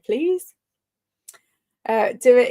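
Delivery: ordinary delivery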